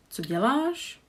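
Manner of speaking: Co děláš said with the pitch climbing on děláš; rising question tune. Shown as a demonstration. Teacher repeats the rising question for learners. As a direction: end co děláš going up. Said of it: "there will be a raising intonation".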